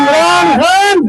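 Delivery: booming political rally speech. Real, not acted. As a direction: call the name out long to the crowd. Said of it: "मेरे नारों का जवाब देंगे".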